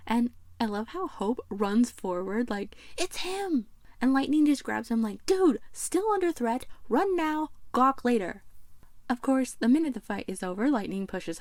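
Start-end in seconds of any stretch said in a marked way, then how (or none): 3.0-3.7 high-pitched, hammed-up thrill; excited outburst. Acting Hope spotting his hero. 5.3-8.4 high, punchy mock order; urgent scolding. Acting Lightning grabbing Hope, urging him.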